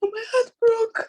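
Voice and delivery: high, slow sobbing words; real heartbroken crying. Real, not acted. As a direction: sob the words out, voice pitched right up. Crying over a friend's accusation.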